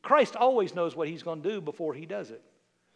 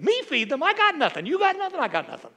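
incredulous disciples; 6 semitones higher, 3 dB louder than usual. Retold or imitated. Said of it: "Mark talks about how he told them".

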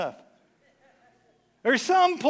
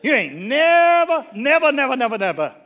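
emphatic repetition; 2 semitones higher, 6 dB louder than usual. Real, not acted. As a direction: pound each never harder. Preacher hammering a point.

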